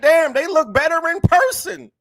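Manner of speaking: high-pitched retold amazement; amazed admiration. Retells his amazement at the crowd.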